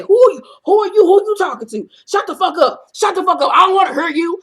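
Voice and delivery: high, loud retold yelling fit; mock furious outburst. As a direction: act out a little man blowing up in rage. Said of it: "go into short man syndrome and say".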